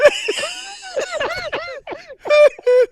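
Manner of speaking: high, loud howl that is half laugh, half sob; loud wailing laugh. Real, not acted. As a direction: let out a shrieking, tearful cackle. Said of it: "what, did, what sound is that?".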